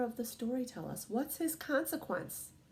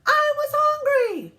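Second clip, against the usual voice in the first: put-on very high, loud, drawn-out kid voice; high little-boy shout; David's excuse; 12 dB louder, slower than usual. A narrator's reading. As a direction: wail it like a defensive child. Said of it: "he says".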